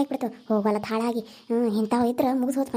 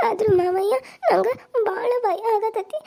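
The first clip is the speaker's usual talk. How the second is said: slow, higher tearful whimper; crying; 6 semitones higher, slower than usual. Acted. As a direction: still sobbing with fear. Niece still crying in fear.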